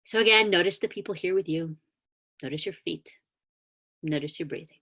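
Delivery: calm meditation guide inviting the congregation to notice; guided grounding meditation. Acted. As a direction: gently lead them back into their bodies.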